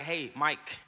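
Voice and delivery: gentle voice of God. Retold or imitated. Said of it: "God was just like".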